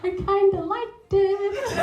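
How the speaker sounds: giggly confession. Real, not acted. Sheepish confession after Vader joke.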